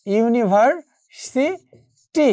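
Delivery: University slowed, pausing before সি and টি; university spelled out slowly by sound; broken into syllables. Shown as a demonstration. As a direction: say university in separated pieces, ইউনিভার-সি-টি. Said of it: "একটু খেয়াল করে দেখো".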